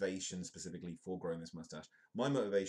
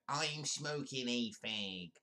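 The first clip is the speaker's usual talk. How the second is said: hammed-up character impression, higher and slow; Wayne and Waynetta; 6 semitones higher, much slower than usual. Host quotes favourite sketch characters, hammed-up slow impression. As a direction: drag the words out in a botched comic impression. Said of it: "I murdered that impression".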